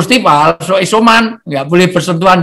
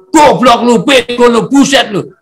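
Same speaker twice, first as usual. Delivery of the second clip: mock angry yelling; 5 semitones higher than usual. Preacher acting an angry man yelling at someone near. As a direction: yell the insults like a hothead losing his temper. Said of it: "Deket kok teriak".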